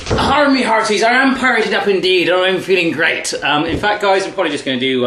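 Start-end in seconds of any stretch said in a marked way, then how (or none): 0.1-3.3 high, hammed-up pirate voice; put-on pirate accent, pitched up. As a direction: greet the crew like a storybook pirate. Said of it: "a normal accent when I'm talking".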